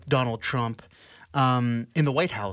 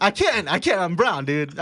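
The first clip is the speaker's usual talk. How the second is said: higher-pitched, through real laughter; laughing protest; 5 semitones higher than usual. Real, not acted. Refusing friends' request to do the accent, laughing. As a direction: laugh while begging off.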